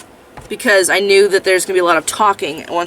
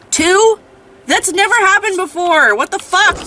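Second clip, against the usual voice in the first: high, loud, incredulous outrage; real gamer rage at full voice; mad shouting; 13 semitones higher, 8 dB louder than usual. Real, not acted. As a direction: yell it in furious disbelief. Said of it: "I'm so mad right now".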